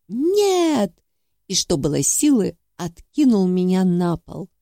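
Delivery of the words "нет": sharp loud shout of no; loud cry. A narrator's reading. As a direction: cry out in sudden alarm. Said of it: "произнёс громкое".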